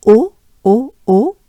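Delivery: "O" stretched with rounded lips; French O sound. Shown as a demonstration. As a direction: round "O" held and repeated. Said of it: "as in".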